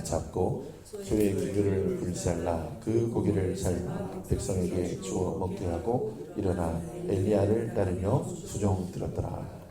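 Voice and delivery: slow, measured reading of the verse; scripture reading. Acted. Pastor leading the church in reading the verse, slow and measured. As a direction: read it out slowly together. Congregation reads verse aloud.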